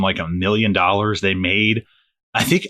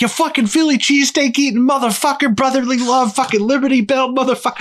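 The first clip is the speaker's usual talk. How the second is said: a comic tearing into the crowd, pitched way up; mock comedian rant. Friend retelling a comic's roast of Philly, high mocking rant. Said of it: "and he's like".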